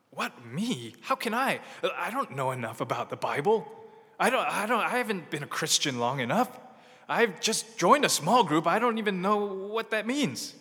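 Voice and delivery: high, rising panicky self-doubt; anxious doubting believer. Acted. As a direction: sound overwhelmed and unsure.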